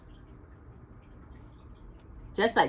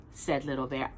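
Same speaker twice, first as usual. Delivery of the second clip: usual speaking voice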